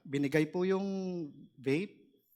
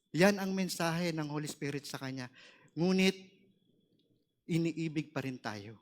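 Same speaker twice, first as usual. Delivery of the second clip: normal talking voice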